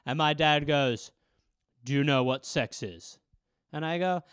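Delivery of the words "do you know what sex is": a hint of a low, stern father; lightly voiced dad, lower. Dad questioning his young son, low and stern.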